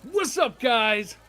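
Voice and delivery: loud, high, drawn-out holler; hammed-up shout to the whole room; brash shouted greeting. Player bursts in to greet the guys, brash shouting.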